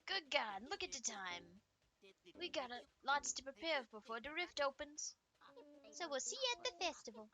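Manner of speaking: lively, loud puppet voice; exaggerated puppet chatter; folksy puppet character. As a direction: put on a high, cartoonish show-host cheer. Plays folksy puppets in a game.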